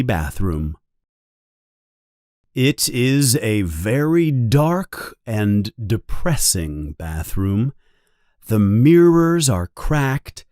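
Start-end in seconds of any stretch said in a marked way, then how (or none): none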